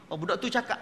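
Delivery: usual speaking voice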